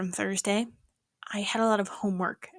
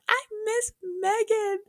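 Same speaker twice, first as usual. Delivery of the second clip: squeaky sad quote; 13 semitones higher, slower than usual. Retold or imitated. Quoting herself missing a friend.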